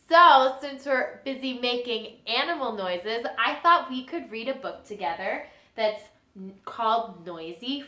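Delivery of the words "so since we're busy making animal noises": ordinary talk, nothing marked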